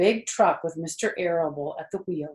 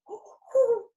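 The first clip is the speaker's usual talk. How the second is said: high, greedy gasps of delight; an eager rat about to burst; excited rat; 5 dB louder than usual. A narrator's reading. Templeton hearing of the feast, excited gasps. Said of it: "eyes were blazing ... wide and excited".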